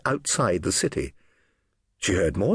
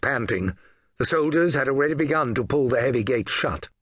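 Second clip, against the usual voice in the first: ordinary delivery, nothing marked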